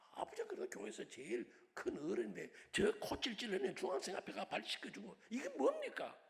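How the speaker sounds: lightly voiced daughter, pushing 뭡니까 harder; daughter's protest. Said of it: "이 생각을 했는지".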